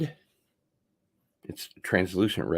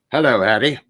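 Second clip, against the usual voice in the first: loud, higher mimicked greeting; Tom Baker; 4 semitones higher, 10 dB louder, faster than usual. Fan mimicking the actor, a loud accented greeting. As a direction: boom out an imitated catchphrase. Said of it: "Tom Baker".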